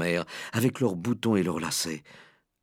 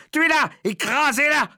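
loud, high, bloodthirsty cries; crowd shouting; 5 dB louder than usual. A narrator's reading. Kitchen staff hunting the mouse, shouting orders. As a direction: whip the mob up to kill. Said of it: "criaient les gens".

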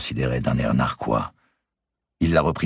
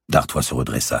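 plain reading voice

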